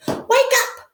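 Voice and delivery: high, loud "Wake up!" at a leaf; yelled. Acted. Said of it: "she yelled".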